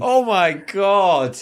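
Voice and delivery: horrified disbelief. Real, not acted. Co-host hears the last sad text, horrified disbelief. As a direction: stretch it out, aghast. Listener reacts to final text.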